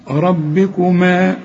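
ربكما slow with a heavy raa and doubled ba. Shown as a demonstration. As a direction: recite ربكما with a full, emphatic raa. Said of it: "الراء مفخمة".

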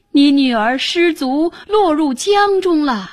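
high, urgent bad news; feigned alarm. A narrator's reading. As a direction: break the news in acted panic. Husband falsely reports a drowning.

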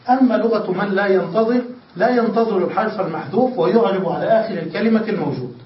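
usual speaking voice

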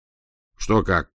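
slow, sullen grumble; grumbled. A narrator's reading. Senya grumbling at Aglaya while washing hands. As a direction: mutter it reluctantly. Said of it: "буркнул тот".

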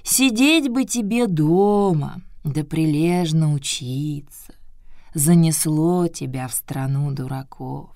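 put-on slow, half-whispered old woman; old turtle voice. Tortila lecturing Buratino.

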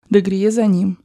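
ordinary delivery, nothing marked

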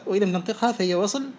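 usual speaking voice

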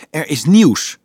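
put-on girl bursting in to share news, excited. A narrator's reading. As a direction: blurt the news out eagerly. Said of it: "vertelde Marieke opgewonden".

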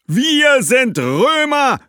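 high, loud and very slow proclamation; Roman ghosts cutting in. A narrator's reading. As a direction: declare it like proud Romans. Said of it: "schalteten sich ein paar andere Geister ein". (sehr langsam, hoch und laut verkündende Römergeister)

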